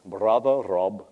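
low, slow and rich; slow, deep greeting in an imitated voice. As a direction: imitate an older pastor's deep voice, drawn out. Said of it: "he said in his beautiful, rich, deep".

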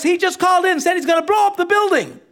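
high, loud urgent yell; yelling officer. Retold or imitated. Officer reporting a bomb threat outside the house, yelling. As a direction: yell the alert like a cop at a standoff. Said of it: "the guy yells".

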